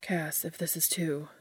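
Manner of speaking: soft unvoiced trailing off; hesitant whisper. A narrator's reading. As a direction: whisper it, unsure.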